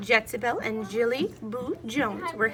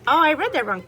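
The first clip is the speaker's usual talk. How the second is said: ordinary delivery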